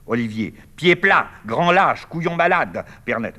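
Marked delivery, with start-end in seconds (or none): 0.8-2.8 high, loud furious name-calling; shouted insults. Acted. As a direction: scream the insults after him. Olivier insulting Michel. (injures hurlées, aiguës et fortes)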